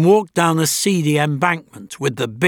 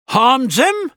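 surprised echo; 10 semitones higher, 4 dB louder than usual. A narrator's reading. Goldsmith echoes in surprise.

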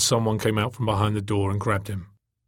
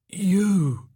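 high, hoarse exclamation; 8 semitones higher than usual. A narrator's reading. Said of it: "he said hoarsely".

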